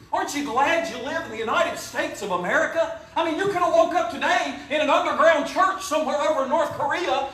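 rally the church about America; rousing preaching. Acted. Patriotic preaching climax.

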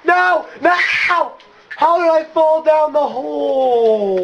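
high, loud wail; dismayed yelling. Real, not acted. Player fell down the hole, dismayed yelling.

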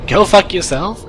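high loud joking yell; mock shout. Streamer shouting a joke insult at his audience. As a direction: bellow it in jest.

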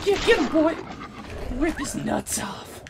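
high, shrill battle cheer; excited cheering. Acted. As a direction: egg the dog on wildly. Cheering on dog in fight.

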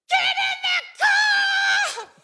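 high, slow, laughing yell; panicked shout. Retold or imitated. Spotting two men coming out, panicked laughing shout. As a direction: yell at everyone to get back in. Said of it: "I look".

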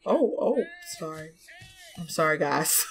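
usual speaking voice

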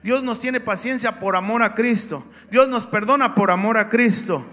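loud, driving preacher voice; shouted sermon refrain; full-force preaching. Acted. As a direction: build the refrain and hammer it home.

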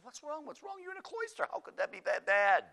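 very high, incredulous questioning; acted high-pitched incredulity; mock disbelief. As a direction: squeak out the questions, building to loud. Priest answers the fretting nun.

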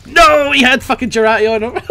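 high, loud disbelieving yell; outraged laughing shout. Real, not acted. Killed by surprise, laughing.

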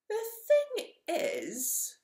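very high, slow, hesitant hedgehog; timid. Acted. Hedgehog timidly objects to the dragon, high and slow. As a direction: stretch it out nervously. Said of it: "said Hedgehog timidly".

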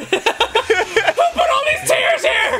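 very high laughing squeal; hammed-up wail of a kid denying tears; mock tearful outrage. Son blaming others for wet pillow, mock outraged squeal.

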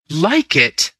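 a startled, appalled cry of disbelief; cried out. Acted. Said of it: "I cried out".

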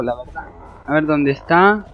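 ordinary talk

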